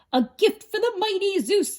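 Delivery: high loud giant cheer. A narrator's reading. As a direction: cheer it proudly. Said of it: "they cheered".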